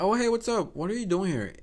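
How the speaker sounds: high-pitched acted greeting; put-on friendly greeting. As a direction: start high, then ease down. Acting out greeting the stranger.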